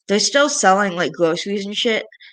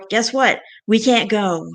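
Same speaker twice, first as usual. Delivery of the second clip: ordinary talk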